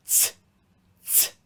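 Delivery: "ts" as a sharp voiceless burst into a hiss; T plus S sound. Shown as a demonstration. Teacher showing first sound of Z, "ts" as in ants. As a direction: release t straight into s for "ts". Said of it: "combination of a T and an S sound".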